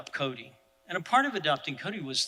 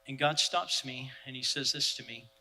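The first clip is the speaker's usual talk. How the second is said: ordinary talk, nothing marked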